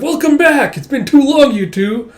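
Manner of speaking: high, loud, delighted welcome; cheerful greeting. Acted. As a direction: greet long-missed friends at full volume.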